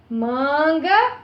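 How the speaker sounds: Manga with a long, stressed "Man"; stress on penultimate syllable. Shown as a demonstration. Teacher showing the strongest syllable of Manga, stressed penultimate. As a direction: stretch out the first syllable of Manga, rising. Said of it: "a penúltima sílaba".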